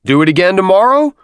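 high, loud officer's call; shouted question. A narrator's reading. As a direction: holler it to the platoon, rising. Captain shouting to the recruits.

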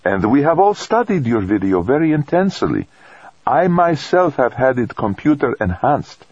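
patiently correcting, lightly Italian; gentle priest voice. A narrator's reading.

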